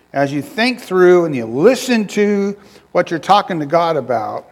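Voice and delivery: normal talking voice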